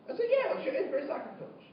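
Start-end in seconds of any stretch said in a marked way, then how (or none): none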